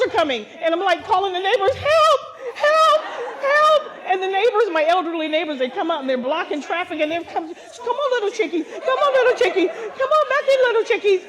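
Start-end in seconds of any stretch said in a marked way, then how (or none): 1.7-3.8 high frantic yell of help; panicked shouts to the neighbors; screaming for help. Retold or imitated. 7.5-11.2 high, loud sing-song coaxing; elderly neighbors calling chicks back; coaxing chickies. Retold or imitated.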